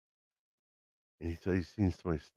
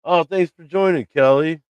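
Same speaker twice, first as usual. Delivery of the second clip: much higher, bright and louder thank-you; real delight at a new member joining; excited thanks; 10 semitones higher, 6 dB louder than usual. Real, not acted. Thanking new channel member live.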